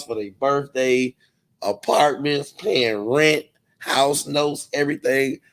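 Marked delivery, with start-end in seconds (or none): none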